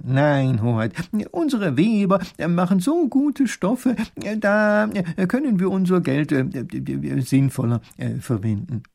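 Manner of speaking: a pedantic minister hemming and hawing; fussy minister. A narrator's reading. Minister advising the grand duke, high and hesitant.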